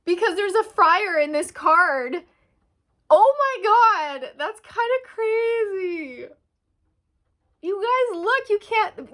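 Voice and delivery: high, loud, amazed squeals; stunned excitement. Real, not acted. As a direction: blurt it out, thrilled and shocked. Shocked by the friar in the card.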